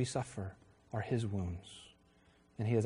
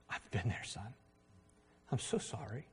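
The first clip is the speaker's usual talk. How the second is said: much softer, gentle words to a hurting son; tender comfort; 2 semitones lower, 11 dB quieter than usual. Retold or imitated. Father comforting his struggling son, soft and tender. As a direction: hush down and comfort him.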